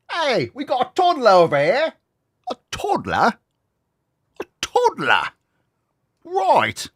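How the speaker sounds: loud, high put-on Cockney, mocking; Cockney accent, yelled across a pub. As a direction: shout it like a cheeky London geezer. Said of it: "I love accents".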